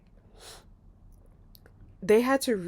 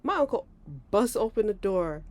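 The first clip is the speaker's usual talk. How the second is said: normal talking voice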